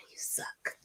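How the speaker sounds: breathy whispered aside after a sigh; sigh, then whisper it teasingly. Real, not acted. Teasing aside while near tears.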